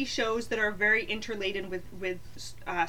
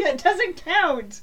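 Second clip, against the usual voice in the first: laughing; 6 semitones higher, 4 dB louder, slower than usual. Real, not acted. Laughing dismissal.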